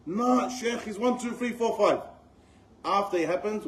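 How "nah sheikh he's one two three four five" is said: mock brother retort. Brother's retorting quote, counting offences.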